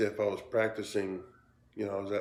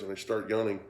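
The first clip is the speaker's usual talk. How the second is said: normal talking voice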